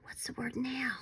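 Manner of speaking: soft whispered question. Shown as a demonstration. Teacher whispering a question to her young students.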